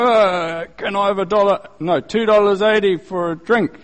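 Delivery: a hint of a drunk asking for money; drunk man. Drunk at the church door begging drink money, lightly mimicked slurry plea. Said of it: "somebody who's drunk".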